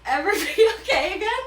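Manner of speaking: bursting into a high, loud laugh mid-line. Real, not acted. Laughing at her own dramatic line.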